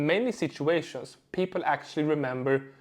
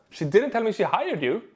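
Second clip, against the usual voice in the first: higher, delighted, swooping pitch; excited; 6 semitones higher than usual. Shown as a demonstration. Coach showing happiness for a friend, excited. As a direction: sound happy for them at the news. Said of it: "If I say it with excitement".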